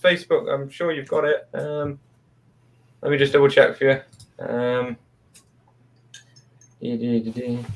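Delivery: talking while chewing; humming through a mouthful; mouth full. Real, not acted. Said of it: "Nick, what are you eating?".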